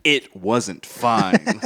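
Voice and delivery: slow deadpan. Retold or imitated. Cohost echoing the line very slowly and low.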